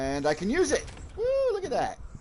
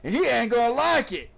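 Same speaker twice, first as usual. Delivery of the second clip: higher, louder excited Australian wildlife-host voice, growing more thrilled; a hammed-up Australian croc hunter, louder and higher; Steve Irwin impression; 8 semitones higher, 4 dB louder than usual. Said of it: "They're making fun of, uh, Steve Irwin".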